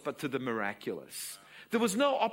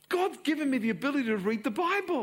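high, loud, proud; excited daughter; 11 semitones higher, 6 dB louder, faster than usual. Acted. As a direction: announce it with joy. Daughter voiced in story.

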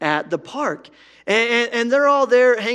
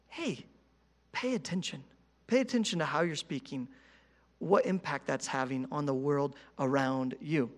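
soft, hushed divine rebuke; God's gentle voice; 5 semitones lower, 9 dB quieter than usual. Retold or imitated. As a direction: say it softly, like God speaking to the heart. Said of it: "have you ever had him say".

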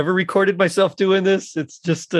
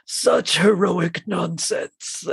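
slow, sneering villain robot; Megatron impression; much slower than usual. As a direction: drawl it slowly with contempt. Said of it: "so Megatron says something like".

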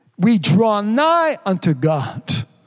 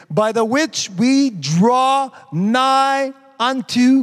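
drawn-out preaching; 3 semitones higher, much slower than usual. Acted. Preacher reads scripture with emphasis.

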